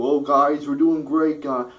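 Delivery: high, loud mock cheer; high, loud sarcastic mimicry; mocking Clinton. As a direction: parody a candidate gushing about how great it's going. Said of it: "Clinton did the same thing".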